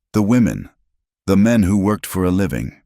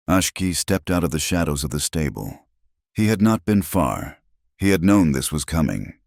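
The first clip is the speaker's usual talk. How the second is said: usual speaking voice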